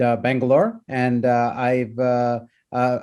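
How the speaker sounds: ordinary talk, nothing marked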